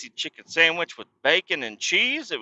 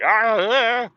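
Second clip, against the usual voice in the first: high, loud panicked yelps of a helpless passenger; 11 semitones higher, 6 dB louder than usual. Retold or imitated. Passenger in a sparking truck, yelping high and loud in panic. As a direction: yelp in alarm, pitched way up. Not in control of the vehicle.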